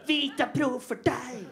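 slow, hammed-up commercial voice with a laugh; mock ad slogan. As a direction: sell it like a cheesy TV ad, slowly. Mocking a TV ad slogan.

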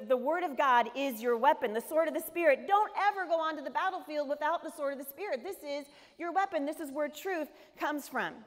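fervent pulpit preaching. Acted. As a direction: preach it hard to the congregation. Preacher drives home the point.

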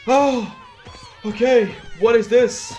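high, crying-out fear; scared cry. Real, not acted. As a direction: whimper it in fright.

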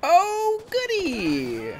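high mock delight at bad news; sarcastic. Real, not acted. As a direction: chirp it with fake cheer. Sarcastic at incoming trouble.

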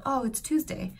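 Tuesday said American, /tuzdeɪ/; plain American Tuesday; American accent. Retold or imitated. Mom answering son, American Tuesday. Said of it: "my American accent".

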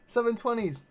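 ordinary talk, nothing marked

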